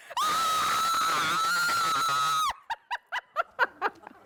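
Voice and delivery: long, very high shrieking laugh on the drop; scream-laugh down the drop. Real, not acted.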